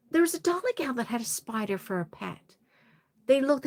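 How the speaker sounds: ordinary talk, nothing marked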